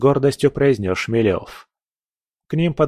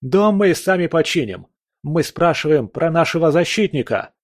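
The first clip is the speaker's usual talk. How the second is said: angry demand; 6 semitones higher than usual. A narrator's reading. Angry villager demanding answers.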